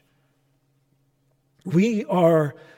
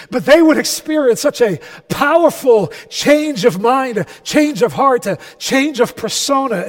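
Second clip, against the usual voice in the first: loud, driving sermon delivery; impassioned preaching; 3 semitones higher, 7 dB louder than usual. Real, not acted. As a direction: preach it with force, punching the key words. Preaches at full force.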